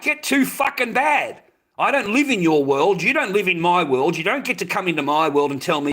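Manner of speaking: heated, defiant telling-off; angry retort. Real, not acted. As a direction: push each line hard at the critics.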